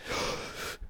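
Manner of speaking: sharp mock gasp of amazement; imitated starstruck gasp. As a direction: gasp like an impressed kid. Kid reacts on hearing of podcast.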